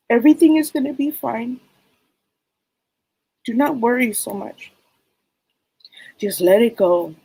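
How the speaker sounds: a hint of grandma comforting her mija; lightly voiced loving grandmother. Grandmother's spirit reassures granddaughter.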